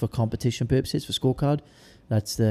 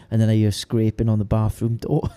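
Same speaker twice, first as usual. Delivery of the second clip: ordinary talk, nothing marked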